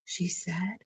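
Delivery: ordinary delivery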